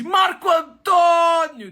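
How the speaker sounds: very high loud shout of his name; elated shout. Acted. Bus conductor shouting his name in high, loud joy. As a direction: cheer him aboard.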